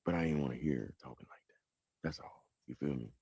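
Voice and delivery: soft unvoiced confession; quiet, emotional whisper to the viewers. Real, not acted. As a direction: drop to a whisper, moved. Emotional aside about grandma.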